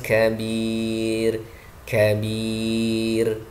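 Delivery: "kabir" with airy k and long final vowel; correct kaf. Shown as a demonstration. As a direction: stretch the end of "kabir" after a light k. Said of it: "tapi kabir". ("kabir" panjang dengan kaf berembus)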